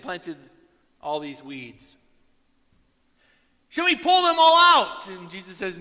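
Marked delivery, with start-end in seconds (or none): 3.7-5.1 much higher, loud question; eager shout. Retold or imitated. Servant asking the master, loud eager shout. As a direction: call out eagerly.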